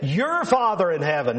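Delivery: "Your" stands out over "Father in heaven"; stress on YOUR. Shown as a demonstration. Preacher reminding believers whose Father it is, stress on "Your". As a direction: hit "Your" as the key word. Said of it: "again, notice that emphasis".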